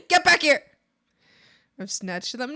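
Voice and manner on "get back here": loud get back here; hammed-up call to stop; mock yell. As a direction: shout after a thief, playfully. Chasing snatched item.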